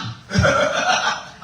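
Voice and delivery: laughing in a high breathy voice. Real, not acted. Preacher laughs at the man pretending nobody is home. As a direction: let out a high airy laugh.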